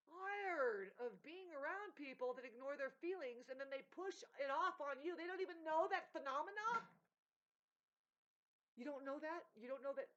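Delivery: loud, high, exasperated outburst; fed-up rant. Real, not acted. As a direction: vent it at full force, fed up. Venting about being fed up.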